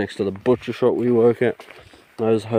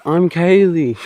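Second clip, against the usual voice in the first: much higher girly voice, imitating; to the man at his table, a mock girly voice; 9 semitones higher, 5 dB louder, slower than usual. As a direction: send up a ditzy girl introducing her boyfriend. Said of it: "she's like".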